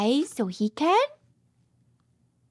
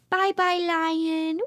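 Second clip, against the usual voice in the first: usual speaking voice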